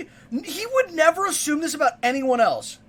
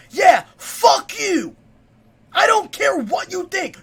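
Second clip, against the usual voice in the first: loud, raised-pitch defiant outburst; angry shouting; 5 semitones higher, 4 dB louder than usual. Real, not acted. Fan cursing anyone who disagrees, angry shouting. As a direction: shout it straight at the doubters. Furious at critics of songs.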